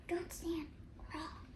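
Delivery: soft unvoiced whisper. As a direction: whisper so the dinosaur won't hear. Whispering near dinosaur.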